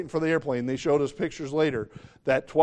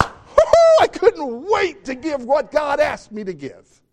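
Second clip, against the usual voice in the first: joyful whoop; 11 semitones higher, 8 dB louder, slower than usual. Acted. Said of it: "This means".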